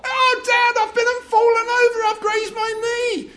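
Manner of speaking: put-on high, loud whiny child; wailing child. Child with grazed knee calling Dad, high and loud. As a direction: cry out to Dad like a hurt kid.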